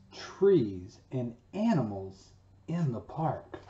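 slow storybook read-aloud; children's teacher reading a poem story. Acted. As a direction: read it to the class, slowly and expressively. Reads the picture book aloud.

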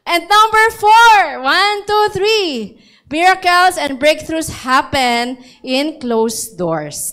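high, loud, slow counting; preacher hyping the fourth point; big announcement. Real, not acted. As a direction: build up the next point dramatically. Announcing sermon point loudly.

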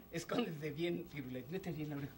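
low, soft hiding voice; hushed instruction. Acted. Master telling dog to hide, hushed. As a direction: murmur it so nobody overhears.

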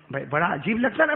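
ordinary talk, nothing marked

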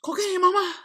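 tossing off a Pryor line; Richard Pryor quote. Retold or imitated. Quoting Richard Pryor after a sneeze, lightly voiced. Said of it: "That's Richard Pryor".

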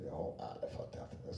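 confused mutter. Retold or imitated. Imitated confused reader.

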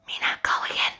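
hushed, whispered direction; whispered cue. Acted. Director cueing Mina to go again, whispered.

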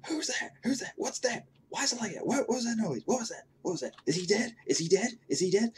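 put-on high-pitched, pestering old-woman voice, loud; squawky imitation of an old lady in a cinema. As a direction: mimic a noisy old lady firing off questions. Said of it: "this really loud, flatulent old lady".

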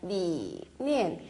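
念 said very slowly and low, tone cut short; half third tone. Shown as a demonstration. Teacher showing the half third tone, slow and low. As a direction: drag out 念 low and soft. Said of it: "사성이니까 반만".

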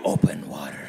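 very low, ominous title read; hammed-up deep movie-trailer voice; mock trailer announcer. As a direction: drop way down to announce the film title.

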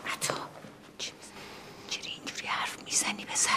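hissed whisper to her son; urgent whisper. Real, not acted. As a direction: scold him under your breath so guests won't hear. Mother hushing her son at gathering.

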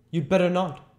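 high, loud "You'd better not"; "You'd better not" pushed out high and forceful; emphatic warning. A narrator's reading. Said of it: "with quiet emphasis".

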